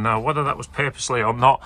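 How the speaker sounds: ordinary delivery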